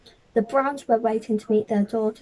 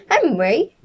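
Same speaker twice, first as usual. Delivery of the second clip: high, louder call of his name; cried out; 4 semitones higher, 6 dB louder than usual. Acted. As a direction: scold him from across the room. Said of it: "cried Miss Brown".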